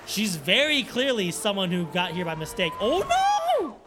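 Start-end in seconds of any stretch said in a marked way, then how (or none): 2.8-3.8 sudden high, loud startled "Oh, no!"; a real gasp of alarm at the crash; genuine shocked cry. Real, not acted. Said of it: "Ooh, that was genuine for me".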